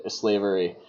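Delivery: ordinary talk, nothing marked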